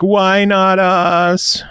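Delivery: high, slow plaintive whine; whining Australians. Retold or imitated. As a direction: whine it like a left-out fan. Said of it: "people in Australia are like".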